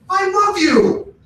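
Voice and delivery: high, slow, wounded I love you; hurt friend. Retold or imitated. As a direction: plead it like a betrayed friend. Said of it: "and you're like".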